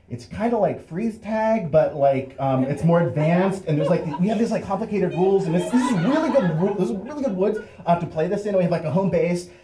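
high, rambling, stumbling excuse; nervous babbling. Acted. Teen inventing a game for the cop, nervous babble.

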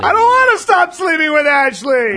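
blurted out in a loud high shout. Acted. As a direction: shout it out before you can stop. Blurts out secret.

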